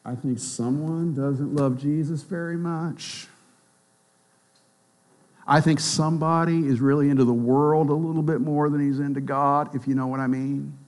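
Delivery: soft, lightly voiced gossipy judgment; judgmental believer. Weak believer judging others, softly gossipy. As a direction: mutter it like a pious gossip.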